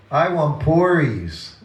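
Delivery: retold child insisting the third time; stubborn child. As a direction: drag out the plea like a stubborn boy.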